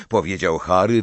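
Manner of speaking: usual speaking voice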